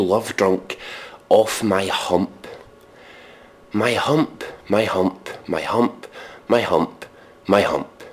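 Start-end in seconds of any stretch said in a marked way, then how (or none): none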